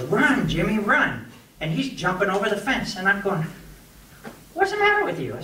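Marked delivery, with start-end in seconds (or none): 0.0-1.3 mild mock yell, pitch raised; retold warning scream. Frightened kid warns Jimmy of the bull, mock scream. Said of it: "he screams and he goes". 4.5-5.4 very high-pitched acted protest; retold exasperated scolding. Cowhand to the panicking kid, exasperated scolding.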